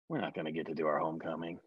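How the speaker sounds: quiet, fast, crestfallen; deflated. Retold or imitated. After Tammy says no, quiet deflated. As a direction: mutter it in defeat.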